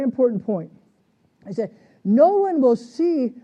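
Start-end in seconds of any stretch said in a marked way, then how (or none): 2.0-3.3 "see" drawn out and loud; loud, slow verse reading landing on "see"; stress on see. Real, not acted. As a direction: lean hard and slow on "see". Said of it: "I want to emphasize the word see".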